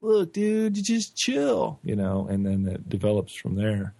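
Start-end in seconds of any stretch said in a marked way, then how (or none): 0.0-1.8 stoner. Retold or imitated. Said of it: "we go".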